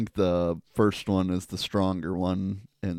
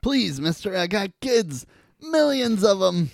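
high, whiny begging bug voice; hammed-up desperate plea; pleading fly; 11 semitones higher, 4 dB louder than usual. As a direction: beg like a tiny fly for mercy. Fly pleads for his life.

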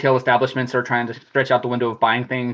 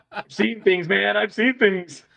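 high, laughing haunted vet; mock traumatized soldier; haunted veteran; 9 semitones higher, slower than usual. Mock war veteran line.

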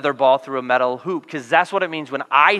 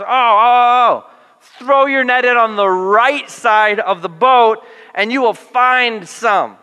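higher, louder, slow excited "oh, oh" then instruction; eager tip; 5 semitones higher, 5 dB louder, slower than usual. Acted. Jesus excitedly telling fishermen where the fish are, higher, louder, slow. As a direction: burst in with a bright idea, drawing it out.

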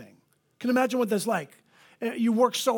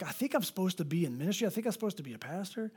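hushed musing; 8 dB quieter, faster than usual. Retold or imitated. Teen self quoted.

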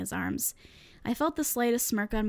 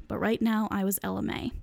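usual speaking voice

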